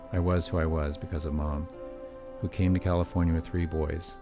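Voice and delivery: ordinary delivery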